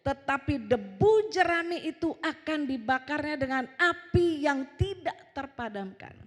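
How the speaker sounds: loud, measured scripture proclamation; slow, weighty preaching on judgment; khotbah lantang. Real, not acted. Berkhotbah dan membaca ayat. (pembacaan ayat lantang dan perlahan)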